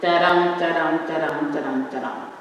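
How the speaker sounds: "da-dum" chanted with the dum stressed each time, fading at the end; iambic rhythm demo. Shown as a demonstration. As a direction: tap out "da-dum" as iambic beats, weak then strong. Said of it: "the pattern that emerges sounds something like".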